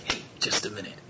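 hushed, breath-only beckoning; coaxing whisper. Acted. Adult calling an unfamiliar child over, softly coaxing.

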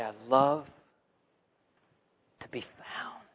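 soft and slow, fading to a whisper; tender. Retold or imitated. God speaking to the seeker, tenderly. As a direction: let it sink to a hushed confession. Voicing God's tender words.

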